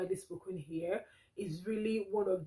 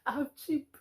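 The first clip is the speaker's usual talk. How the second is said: higher, giggling through the words; laughing admission; 6 semitones higher than usual. Real, not acted. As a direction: laugh it out, embarrassed. Laughs at her own admission.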